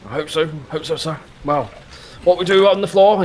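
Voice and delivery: put-on high, stiff old soldier; a proud veteran sizing up a recruit; old army man voice. Mr Muntz voice.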